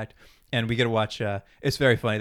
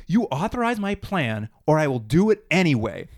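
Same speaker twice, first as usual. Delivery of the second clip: mock-angry demand, pitched up, then punched louder; angry ultimatum; 3 semitones higher, 5 dB louder than usual. Sam pointing at Leonard with an ultimatum, mock angry. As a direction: deliver an indignant ultimatum in retelling. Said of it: "he's, like, so angry".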